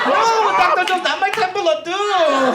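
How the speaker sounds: teasing damsel voice. Pretend. Teasing her boyfriend in bed, high coy damsel voice. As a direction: play the swooning damsel to tease him. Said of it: "And I'll just be like".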